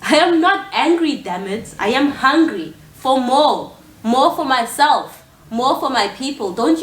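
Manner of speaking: exasperated, heated denial; angry outburst. Acted. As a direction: insist hotly that you are not angry. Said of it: "Why are you shouting? Why are you so angry?".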